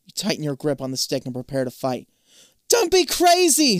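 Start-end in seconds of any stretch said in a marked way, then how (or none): 2.7-3.8 high, loud drawn-out shout. A narrator's reading. Zoe warns you from the pool, shouting. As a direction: call out urgently from across the pool. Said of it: "Z-, Zoe shouts from the pool".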